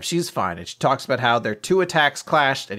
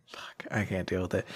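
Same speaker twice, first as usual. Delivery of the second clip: softer, breathless whisper after laughing; 5 semitones lower, 10 dB quieter than usual. Real, not acted. After cracking up at the joke, real whisper.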